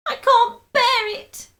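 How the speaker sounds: very high, loud, slow wail; anguished cry. A narrator's reading. Terrified wife wails high and loud. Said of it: "cried Missus Twit".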